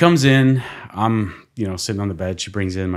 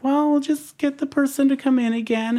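high, lightly mocking nurse voice; dismissive nurse. Nurse brushing off the sick patient, high mock voice. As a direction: float it up high, brushing him off.

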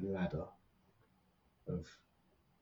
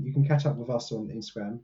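normal talking voice

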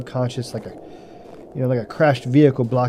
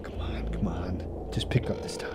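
slow, unvoiced, much softer pleading; desperate whisper; 5 semitones lower, 11 dB quieter than usual. Begging the phone to connect, desperate whisper. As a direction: whisper so whatever is out there cannot hear.

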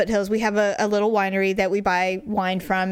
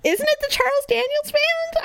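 very high, squeaky questioning; high-pitched disbelief. Real, not acted. Woman asking co-host about the band name, squeaky disbelief. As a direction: squeak it out in genuine doubt.

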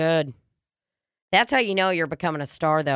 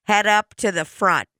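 slow store loudspeaker page, slightly raised; PA announcement; 3 semitones higher, slower than usual. Pretend. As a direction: page her over the store speakers.

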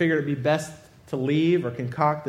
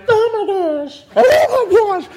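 high, loud shocked cries; shrieking lady; 9 dB louder than usual. Retold or imitated. Shaken operator seeing patient emerge, high shriek. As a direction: imitate the old lady shrieking in dismay. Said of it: "and the lady's like".

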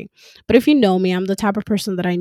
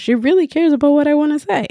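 delighted realization; 8 semitones higher, 5 dB louder, faster than usual. Retold or imitated. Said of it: "And then she said".

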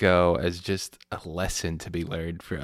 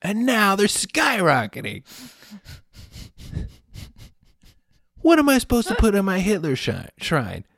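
higher, louder, outraged whining customer; indignant complaint; 12 semitones higher, 8 dB louder than usual. Retold or imitated. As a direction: rant like a cheated collector, slowing on key words. Mocking neo-Nazi collector complaining.

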